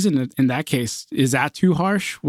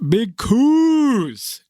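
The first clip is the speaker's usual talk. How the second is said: long, high swooping holler on the nickname; drawn-out hype call; 8 semitones higher than usual. Pretend. Host introducing the Lakers' win, drawn-out hype call. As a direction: stretch the vowel like a crowd cheer.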